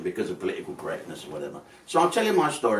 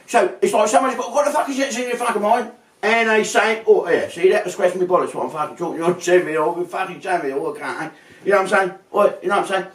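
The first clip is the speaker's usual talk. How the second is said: high, rapid mock Scouse; comic Scouse chatter, pitched up; put-on Scouse accent; 7 semitones higher, faster than usual. Shown as a demonstration. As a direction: gabble in an exaggerated Scouse accent. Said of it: "I'll put on a little fucking accent".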